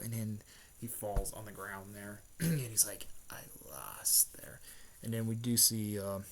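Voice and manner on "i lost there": low, slow, whispered admission of defeat. Retold or imitated. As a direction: let it drop out low and slow. Defeated villain, retold.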